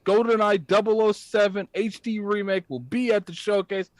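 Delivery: normal talking voice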